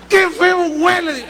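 high, loud, slow disgusted drawl; disgusted complaint. Retold or imitated. Preacher retells complaining of the stench, slow and high. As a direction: stretch out the disgust.

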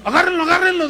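high, loud commanding call; mock urgent order. Exorcist orders helpers to hold the man, loud and high. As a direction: order them to grab him.

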